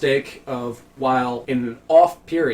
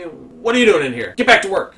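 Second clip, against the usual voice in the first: sharp, louder boss barking orders; angry supervisor; 5 semitones higher, 5 dB louder than usual. Retold or imitated. Supervisor scolding a worker, sharp and loud. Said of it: "the supervisor looks at me".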